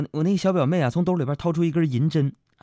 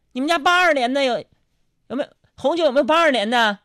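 high, loud demand; show-off customer; 11 semitones higher, 5 dB louder than usual. Retold or imitated. Diner showing off about vintage wine, high pompous demand. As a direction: ask loudly, putting on airs.

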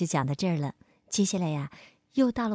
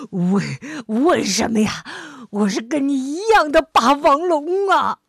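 panting; 7 semitones higher, 8 dB louder than usual. Acted. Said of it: "霸王龙喘着粗气说".

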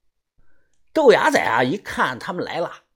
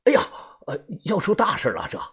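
flustered guide, higher-pitched, stumbling; anxious; 7 semitones higher, faster than usual. A narrator's reading. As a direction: blurt it out with hesitant worry. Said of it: "向导老杨是一脸的着急". (着急慌张地说)

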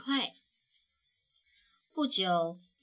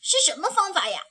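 fast, high and louder, can't wait; eager cub; 7 semitones higher, 7 dB louder, much faster than usual. Acted. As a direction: rush out the question excitedly. (小熊急切追问，又快又高)

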